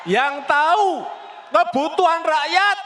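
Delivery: rising to a fiery shout; building rally shout. Real, not acted. Rising rally speech climax.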